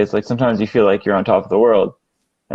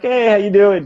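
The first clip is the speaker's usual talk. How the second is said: friendly mock greeting; 9 semitones higher than usual. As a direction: greet it like an old classmate. Greeting anger in classroom.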